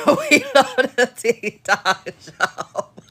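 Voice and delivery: slow quote broken by laughs; giggling imitation; laughing quote. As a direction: laugh through the catchphrase. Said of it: "She kept saying".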